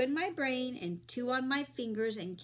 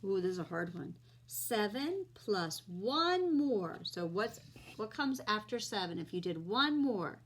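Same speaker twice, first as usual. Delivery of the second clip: put-on friendly teacher talking to kids; kids show host. Teaching kids addition on camera.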